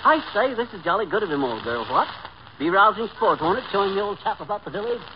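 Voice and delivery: hammed-up English gent; affected British chumminess; mock British accent. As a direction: put on a jolly British toff act. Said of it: "Some of the English has come off on you".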